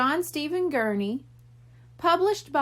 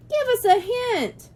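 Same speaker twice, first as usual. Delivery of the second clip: put-on high excited boy voice; high kid voice calling out for a hint; 11 semitones higher, 3 dB louder than usual. A narrator's reading. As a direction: call out like an eager kid. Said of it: "one boy called out".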